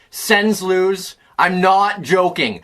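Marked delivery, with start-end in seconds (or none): none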